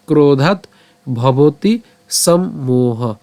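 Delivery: ক্রোধাৎ, ভবতি, then সম্মোহ, each word set apart; teacher sounding out ক্রোধাৎ ভবতি সম্মোহ piece by piece; broken-up pronunciation. Shown as a demonstration. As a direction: slowly split সম্মোহ into sam and moha. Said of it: "উচ্চারণটা কেমন হচ্ছে।".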